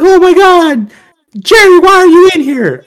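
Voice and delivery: very high, loud shriek of surprise; imitated shocked principal. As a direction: cry out in mock alarm like his principal. Principal shocked to see him.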